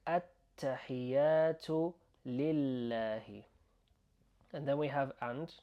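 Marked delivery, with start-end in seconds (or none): 0.1-3.4 attahiyatu lillahi drawn out, doubled letters held; slow careful demonstration. Shown as a demonstration. Teacher joining the words for learners, attahiyatu lillahi slow and careful. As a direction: stretch attahiyatu lillahi sound by sound. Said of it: "so we're saying".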